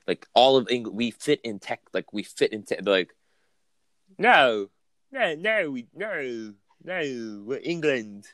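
hammed-up general British accent, high and lilting; mock British accent. English townsfolk insisting in a mock British accent. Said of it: "How do you like my accent".

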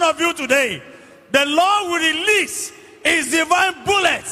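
forceful preaching. Real, not acted. Preacher praying victory over giants for every listener, forceful declaration.

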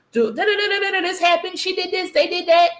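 lightly mimicked venting caller; mock venting. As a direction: breeze through someone else's complaints. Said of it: "They was like".

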